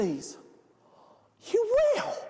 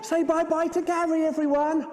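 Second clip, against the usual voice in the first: ordinary talk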